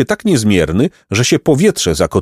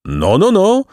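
high, incredulous "no, no, no"; storybook disbelief, pitched way up; disbelieving; 11 semitones higher, slower than usual. A narrator's reading. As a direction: marvel as if you doubt it. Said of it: "zdumieli się z niedowierzaniem".

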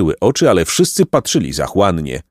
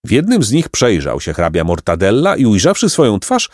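plain reading voice

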